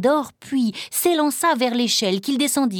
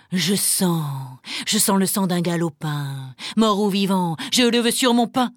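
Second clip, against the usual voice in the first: growling ogre. Acted. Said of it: "gronda celui-ci en humant l'air".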